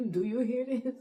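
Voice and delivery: neighbour's alarmed look across the patio; alarmed question. Retold or imitated. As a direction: ask it wide-eyed across the patios.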